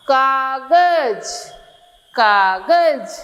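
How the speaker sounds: "कागज" stretched slowly, every syllable held long; drawn-out syllable reading. Shown as a demonstration. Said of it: "क्या हो गया". ("कागज" धीरे-धीरे खींचकर)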